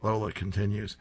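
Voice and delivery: usual speaking voice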